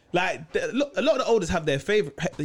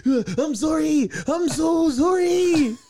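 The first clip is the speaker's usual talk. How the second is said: high, loud blubbering apology; mock crying; 11 semitones higher, 4 dB louder than usual. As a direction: sob out an apology to mum. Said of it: "and I'm crying".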